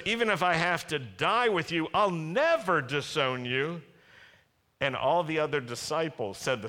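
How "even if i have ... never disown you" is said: high, emphatic boast; swaggering vow, stretched out on the last part; talking big. Acted. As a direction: declare it as a big, loud promise. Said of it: "talking big".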